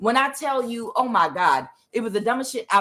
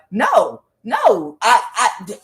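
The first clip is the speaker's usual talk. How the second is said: higher, louder flustered protest; wife pushing back at her husband, upset and loud; 4 semitones higher, 4 dB louder, slower than usual. Retold or imitated. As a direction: stammer it out, upset and indignant. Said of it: "she was really getting upset".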